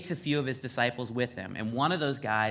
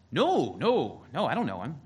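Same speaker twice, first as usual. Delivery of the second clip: raised, swooping retold denial; first denial; 4 semitones higher, 6 dB louder than usual. Peter answering the servant girl, a mock protest of innocence. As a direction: brush off the accusation, acting it out. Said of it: "Peter gets a little firmer".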